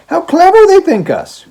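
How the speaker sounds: high, loud sarcastic marvel; mock the idea with wide-eyed glee; mock wonder. Daughter laughing at the judge's notion, mock amazed.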